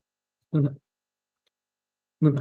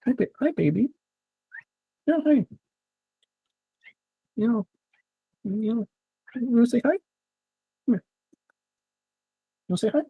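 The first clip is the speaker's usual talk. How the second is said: much higher, soft coo for the cat; 8 semitones higher, 4 dB quieter than usual. Real, not acted. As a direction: sweet-talk the cat to come over. Baby-talks to his cat.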